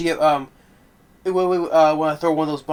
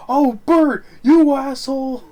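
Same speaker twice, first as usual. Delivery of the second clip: much higher, louder, slow mock outrage; angry yell at Bert; 12 semitones higher, 8 dB louder, slower than usual. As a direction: yell it like a furious cheated friend. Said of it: "He's like".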